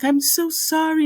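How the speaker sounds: high, pleading sorry; high-pitched mock weeping apology; tearful apology. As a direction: voice her as a weepy, apologetic victim. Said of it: "the empathetic woman is like".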